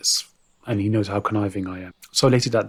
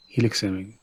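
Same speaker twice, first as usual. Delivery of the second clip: ordinary talk, nothing marked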